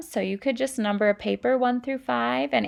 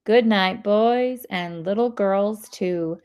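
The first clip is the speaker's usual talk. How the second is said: put-on louder, lively read-aloud voice for kids; reading to children; 8 dB louder than usual. Teacher reading a sound-word book to students, lively. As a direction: bring the story alive for the class.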